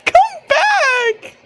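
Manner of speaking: very high, loud shriek with laughter; laughing shriek. Real, not acted. Player shrieking at the character to come back, very high. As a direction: shriek after him, half laughing.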